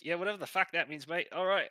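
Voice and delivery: put-on high, loud, quick Australian accent; Pilfer. Pilfer shrugs off the thanks, loud Australian accent. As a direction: toss it off as a brash Aussie. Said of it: "And Pilfer goes".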